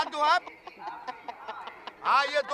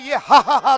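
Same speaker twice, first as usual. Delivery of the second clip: louder, hammed-up "हा, हा" fake laugh; mock fake laugh; 12 dB louder, slower than usual. Shown as a demonstration. Host mimics contestant's fake ha-ha laugh. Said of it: "तो ये बनावटी हो गई".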